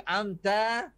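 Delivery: amta said with a light thin ta, high and loud; a thin ta in Amta with no heavy vowel. Shown as a demonstration. Ustaz calling out amta loudly to the students. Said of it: "Ta nipis".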